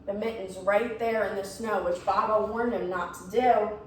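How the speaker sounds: ordinary delivery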